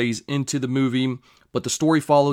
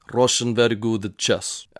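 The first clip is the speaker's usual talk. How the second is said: put-on light, half-serious Russian accent; a quick joking Russian accent, not fully committed; mock Russian accent. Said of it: "I hope that was a fucking good accent".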